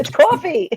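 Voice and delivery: coffee with a high, open British o. Shown as a demonstration. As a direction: pronounce coffee the London way. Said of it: "changed the way I said coffee".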